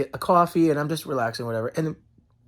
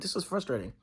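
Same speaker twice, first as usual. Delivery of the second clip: inner thought; 2 semitones lower, 14 dB quieter, faster than usual. Retold or imitated. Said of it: "I was just thinking that".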